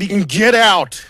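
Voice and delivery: loud, high, drawn-out "get out"; mock outburst. Caller ranting about the German coach, mock outburst. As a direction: belt it out high and slow like an indignant patriot.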